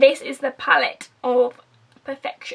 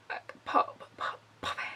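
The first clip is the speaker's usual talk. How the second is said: breathy whisper; 14 dB quieter than usual. Real, not acted. Gushing over highlighter on collarbones.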